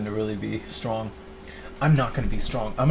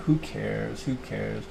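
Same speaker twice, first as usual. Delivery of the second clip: weary shrug; 5 dB quieter, much slower than usual. Retold or imitated. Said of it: "now I'm just kinda like".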